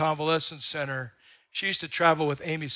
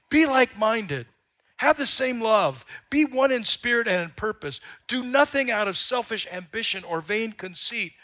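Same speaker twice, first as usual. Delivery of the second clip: a touch of pleading apostle, higher and louder; Paul pleading; 8 semitones higher, 5 dB louder than usual. Retold or imitated. Paul urging the church to unity, pleading. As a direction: urge the church like Paul begging them. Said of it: "Paul doing his Stella".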